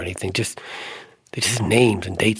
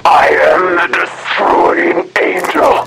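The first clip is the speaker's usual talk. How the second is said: slow, loud demonic roar; a demon naming itself with menace; 8 semitones higher, 6 dB louder, much slower than usual. Acted. Possessed girl declaring itself to the man in a roar. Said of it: "in this low-pitched voice".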